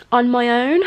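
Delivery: ordinary delivery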